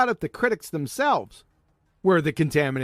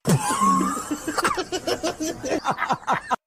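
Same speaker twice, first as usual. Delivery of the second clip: smug sarcastic chuckling. Real, not acted. Mocking laugh at the biologist.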